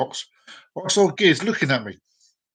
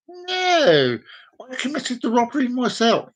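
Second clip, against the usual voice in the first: high-pitched put-on driver voice; confessing driver; 9 semitones higher than usual.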